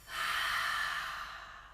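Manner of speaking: long audible sigh out through the mouth; airy, voiceless releasing sigh; demonstrated exhale. Shown as a demonstration. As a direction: breathe out slowly and fully. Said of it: "breathing out through the mouth".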